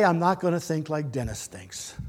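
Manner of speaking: ordinary delivery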